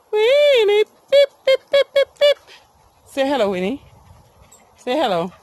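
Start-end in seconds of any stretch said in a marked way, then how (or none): 0.1-2.4 high loud beeps like a horn; playful beep call. Pretend. Owner beeping playfully to hurry her dog along. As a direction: beep at her like a little car.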